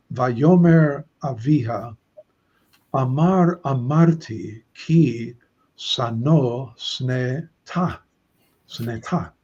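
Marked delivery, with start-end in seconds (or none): 0.1-8.0 slow, careful Hebrew "Vayomer Aviha, Amar Amarti"; teacher reading a Hebrew verse aloud to the class, slow and careful. Shown as a demonstration. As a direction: read the Hebrew slowly, syllable by syllable. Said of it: "So the dad says".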